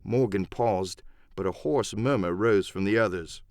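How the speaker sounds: plain reading voice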